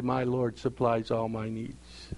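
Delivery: soft, slow and settled, trusting calm; calm at peace. Pretend. Believer answering the storm, serene and softly slow. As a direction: let it rest in quiet trust. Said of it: "have you stood there at peace and say".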